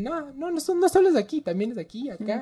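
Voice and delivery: high, hurried pointing voice; alarmed doctor. Retold or imitated. Imagining a doctor listing bald spots, high and hurried. Imagining the diagnosis being delivered.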